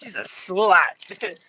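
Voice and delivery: slow, dopey cartoon voice; Beavis and Butthead. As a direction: draw out the last word like a dim cartoon teen. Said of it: "on the Beavis and Butthead Do".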